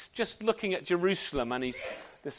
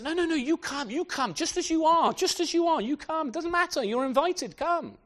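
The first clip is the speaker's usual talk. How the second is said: higher, eager welcoming host; eager welcome; 9 semitones higher than usual. Retold or imitated. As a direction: urge them in warmly, no excuses accepted. Host voiced inviting guests.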